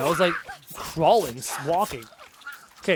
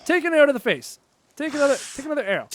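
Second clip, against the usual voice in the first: raised, loud battle taunt; taunting call; 8 semitones higher, 4 dB louder, faster than usual. Pretend. Shooting arrows at an enemy, loud taunting. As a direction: call it high while firing.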